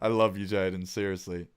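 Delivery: ordinary delivery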